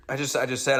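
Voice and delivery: normal talking voice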